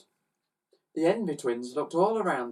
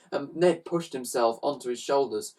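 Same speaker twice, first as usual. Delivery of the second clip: usual speaking voice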